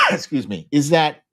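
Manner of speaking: ordinary talk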